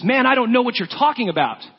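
panicked dismissal, pitched high; indignant denial. Retold or imitated. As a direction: wave him off, flustered. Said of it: "Peter answered this man".

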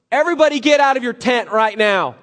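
loud, high order to the whole camp; commanding shout. Retold or imitated. Joshua ordering everyone out of their tents, loud. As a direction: bark it across the camp.

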